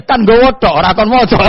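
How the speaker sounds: high, loud, laughing shout; Umar's cheeky retort. Acted. Umar retorting to Mungkar Nakir, a high laughing shout.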